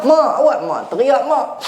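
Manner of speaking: high, puzzled question; puzzled child. Retold or imitated. Son puzzled why his mother is crying, high and bewildered. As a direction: ask it baffled. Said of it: "Anak pun pelik".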